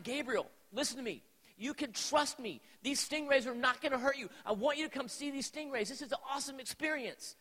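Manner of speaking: retold exasperated father laying down the law; mildly angry, emphatic insistence; getting mad. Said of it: "And I'm getting mad".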